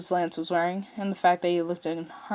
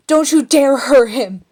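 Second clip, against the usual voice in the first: loud, slow, higher threat; furious yell; 6 semitones higher, 11 dB louder, slower than usual. A narrator's reading. Shiro warning Hagar off his mate, furious yell. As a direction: shout the warning with fury. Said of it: "Shiro yelled".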